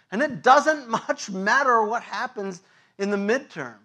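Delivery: loud, rising pulpit preaching; preaching at full force. Acted. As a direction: drive it home like a fired-up preacher.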